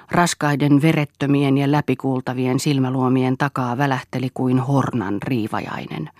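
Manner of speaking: usual speaking voice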